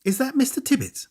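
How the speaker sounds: put-on girl on the telephone line; girl on the phone. A narrator's reading. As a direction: lift into a girl's voice. Girl's voice on telephone.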